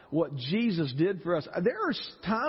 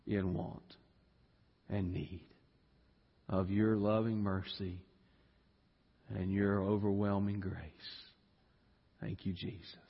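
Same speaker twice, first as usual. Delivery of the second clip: quiet prayer; 11 semitones lower, 6 dB quieter than usual. Acted. Preacher praying humbly before God at the close, soft and low. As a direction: pray it humbly and softly, almost to yourself.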